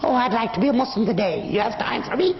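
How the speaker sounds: high-pitched put-on pleading; exaggerated little high voice asking a favor; mock eager convert. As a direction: mimic a sweetly eager would-be convert. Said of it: "I didn't come up to Islam saying".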